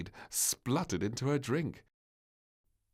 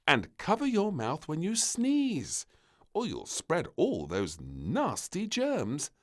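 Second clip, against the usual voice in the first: stern maid; 12 semitones higher, 9 dB louder than usual. Acted. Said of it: "Maid frowned".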